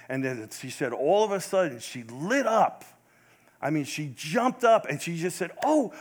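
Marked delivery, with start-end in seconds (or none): 5.6-5.9 high, loud, awestruck cries of wonder; dying mother seeing heaven, overjoyed; excited awe. Retold or imitated. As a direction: gasp at a beautiful vision. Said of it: "And she was so excited".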